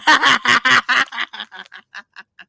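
bursting out laughing; loud laugh. Real, not acted. Laughing at scammer.

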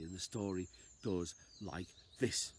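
usual speaking voice

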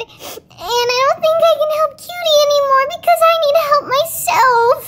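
high, frightened confession; acted rising panic; really scared. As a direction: admit helplessness in a high voice, slowing at the end. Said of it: "and I'm really scared".

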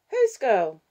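put-on high, loud little-girl voice; curious child. Six-year-old breaking in on her grandparents, curious child voice. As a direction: pipe up loudly as a small child.

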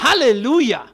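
ringing full-voiced Halleluja; 1 loud hallelujah. Real, not acted. Preacher shouting Halleluja to his congregation.

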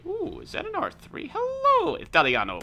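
high squealed greeting. Acted.

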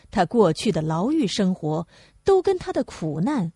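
plain reading voice

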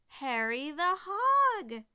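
high, loud, drawn-out announcement; big storytelling climax; triumphant reveal. A narrator's reading. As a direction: proclaim the name slowly and grandly. Triumphant reveal of the lost hog.

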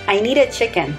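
usual speaking voice

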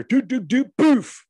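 rising doo-doo-doo, loud poof; imitated crash noise. Dad retelling the fall he heard, imitated crash noise. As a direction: mimic a tumble ending in a loud high poof.

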